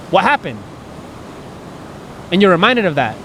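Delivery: normal talking voice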